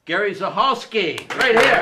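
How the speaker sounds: loud, rising call to applause; big crowd-rousing introduction; emcee introduction. Real, not acted. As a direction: build up and shout the performer's name. Host introduces the next performer.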